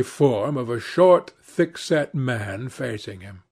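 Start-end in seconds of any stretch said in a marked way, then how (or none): none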